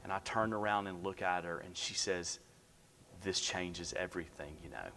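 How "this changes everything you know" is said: much softer and lower; hushed, weighty. Retold or imitated. Nurse turning back to a new dad, hushed and weighty. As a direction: let it land as a quiet, knowing remark.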